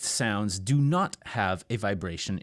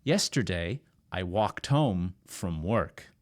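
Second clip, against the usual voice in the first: slow model sentence; 5 semitones lower than usual. Shown as a demonstration. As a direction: lower and slow, walked home said carefully. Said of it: "Repeat after me".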